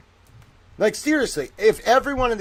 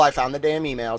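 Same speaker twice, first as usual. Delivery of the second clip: ordinary talk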